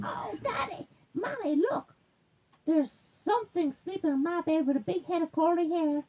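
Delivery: high-pitched alarmed cub; baby bear. A narrator's reading. Baby bear finding a sleeper, high-pitched alarm. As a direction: pipe up high like a startled cub. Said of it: "And the baby bear says".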